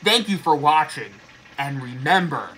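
muffled behind latex mask; speaking inside rubber mask; through a face mask. Real, not acted. Seen 1: Full latex mask covers mouth until about 3.4s; then removed.

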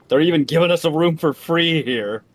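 sarcastic excitement. Real, not acted. Sarcastic joke about free room.

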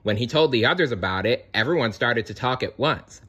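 plain reading voice

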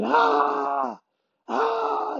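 high hammed-up yells imitating Jon; imitated screaming. Fighter imitating Jon Jones screaming at him in the gym. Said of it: "Jon Jones was screaming".

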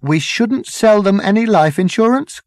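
high-pitched heckle from a wag; called-out quip. A narrator's reading. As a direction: toss a joke out from the crowd. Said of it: "called out a wag".

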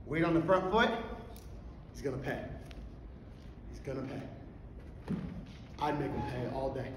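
usual speaking voice

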